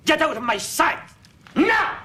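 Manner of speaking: roaring command to leave; furious shout. Acted. Furious emperor dismisses princes.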